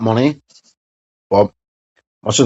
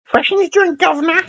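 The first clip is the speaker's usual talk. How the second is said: very high-pitched English caricature; mock English accent. Mocking the English about their accents, in a high squeaky English accent. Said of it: "My best accent's".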